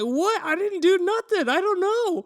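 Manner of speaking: high, loud protest of innocence; defensive. Retold or imitated. Teen boy accused by the girls, high defensive protest. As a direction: throw your hands up, you did nothing.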